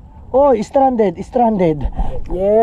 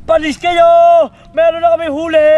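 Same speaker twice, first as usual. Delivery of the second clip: excited shout; 6 semitones higher, 5 dB louder than usual. Real, not acted. Shouting to friends about catch.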